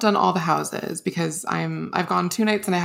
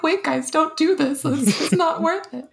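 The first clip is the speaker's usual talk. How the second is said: pleading mascot voice; 9 semitones higher than usual. Retold or imitated. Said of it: "He's like".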